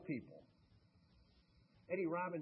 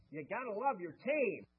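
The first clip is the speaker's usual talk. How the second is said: slow, higher imitation of a Tennessee drawl; 6 semitones higher, 6 dB louder than usual. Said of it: "in that wonderful Tennessee drawl".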